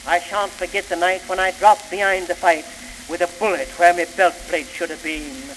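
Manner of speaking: plain reading voice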